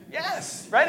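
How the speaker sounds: normal talking voice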